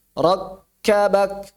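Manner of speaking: "Rakkabak" slow, stopped silently on the k; slowly block the k in "Rakkabak", then release; cut-off sound. Shown as a demonstration.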